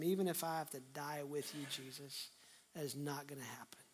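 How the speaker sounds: very soft, low, dropping to a whisper; hushed vow. Acted. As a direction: vow it under your breath to a beloved master. Voicing Peter vowing loyalty to Jesus.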